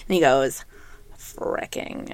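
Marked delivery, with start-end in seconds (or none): 1.2-2.1 hushed, unvoiced retold confession; child's secret whisper. Kid revealing his F word, imitated whisper.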